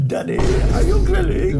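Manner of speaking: squeaky mock child asking daddy. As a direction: pipe it up like a little kid. Mock child reacting to My Grill.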